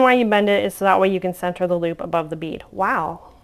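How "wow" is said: usual speaking voice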